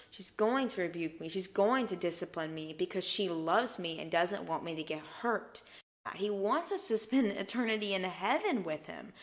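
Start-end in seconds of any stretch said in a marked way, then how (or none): none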